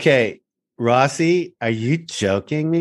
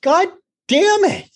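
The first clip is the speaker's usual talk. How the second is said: exasperated cry; 3 dB louder than usual. Real, not acted. Reacting to dog eating fawn.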